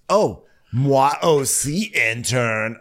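put-on slow, clunky American-French accent; bad French accent. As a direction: say it slowly with no real accent. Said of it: "not even trying to do a French accent".

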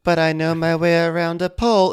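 a boastful Whitney impression; mock Whitney.

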